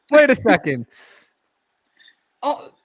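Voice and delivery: high, loud sudden double take; surprised. Real, not acted. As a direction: caught off guard, stop everything.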